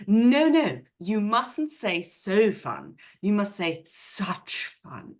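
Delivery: put-on posh English accent, schooling someone; Miriam Margolyes. Host imitates an actress correcting somebody's grammar, drawn-out posh accent. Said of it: "she will say to somebody".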